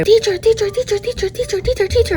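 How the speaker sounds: put-on high, pleading kid calling teacher over and over; whiny kid voice. Kids tugging at the substitute for attention, high whiny calls. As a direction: nag like a needy little kid. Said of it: "they want your attention every second".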